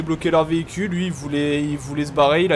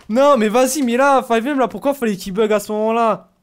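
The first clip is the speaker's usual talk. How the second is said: ordinary talk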